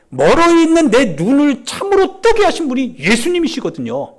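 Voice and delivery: high, forceful pulpit testimony; insistent, ringing testimony; impassioned preaching. Acted.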